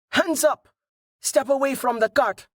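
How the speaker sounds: very high-pitched shouted orders; high, urgent challenge. A narrator's reading. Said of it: "the man shouted".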